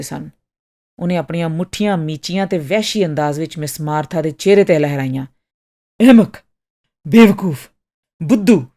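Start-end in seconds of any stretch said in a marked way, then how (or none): 6.0-8.7 shouted name-calling, each word a separate loud burst; loud insults. A narrator's reading. Enraged customer raging at Miss Martha, loud and angry. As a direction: hurl each insult loudly, one by one. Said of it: "ਬਹੁਤ ਹੀ ਉੱਚੀ ਆਵਾਜ਼ ਵਿੱਚ ਬੋਲ ਰਿਹਾ ਸੀ".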